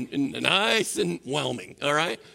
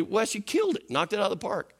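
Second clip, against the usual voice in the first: usual speaking voice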